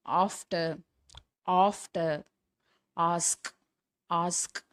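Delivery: "after", "ask" with a long open "aa"; long ஆ demo. Shown as a demonstration. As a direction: model "after" and "ask" singly, starting on "aa". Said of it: "அடுத்தது ஆ". ("after", "ask" நீண்ட ஆ ஒலியுடன் தனித்தனியாக)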